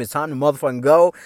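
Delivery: ordinary talk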